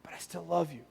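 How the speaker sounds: a hint of a tender father; gentle fatherly reassurance. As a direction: reassure a child who messed up. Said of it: "a dad hugging his kid".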